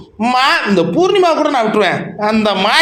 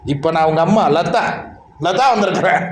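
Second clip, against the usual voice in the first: mock mother Latha; 6 semitones lower than usual. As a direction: play the visiting mother, voice dropped low. Acting as mother Latha arriving.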